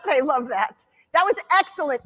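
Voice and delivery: ordinary talk, nothing marked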